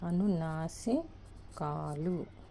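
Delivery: అనునాసికాలు drawn out syllable by syllable, very slow; slow dictation. Shown as a demonstration. Teacher spelling a term for her class, very slowly. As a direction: stretch out అనునాసికాలు for students to write. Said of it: "అంటే ముక్కుతో పలుకుతాం".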